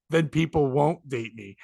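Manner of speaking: ordinary delivery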